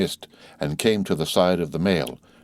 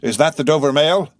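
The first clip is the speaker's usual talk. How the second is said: calling from a distance; 8 semitones higher, 5 dB louder than usual. A narrator's reading. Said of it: "a man's voice called from the mist".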